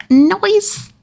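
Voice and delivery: goofy shouted "Noise"; playful yell. Real, not acted. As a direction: blurt it out as a silly shout. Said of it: "something me and my fiance say".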